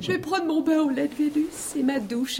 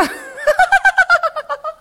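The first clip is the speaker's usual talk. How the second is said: very high, louder peal of laughter; mocking laugh; 6 dB louder than usual. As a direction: laugh right in his face. Mocking the landlord to his face.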